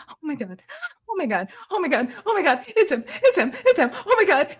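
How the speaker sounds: starts hushed, builds to high squeals; fan freak-out. Acted. Cowboy-booted fans spot their idol, building starstruck freak-out. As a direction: starstruck gushing, climbing in pitch with each repeat. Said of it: "early stages of, like, Beatle stan freak out".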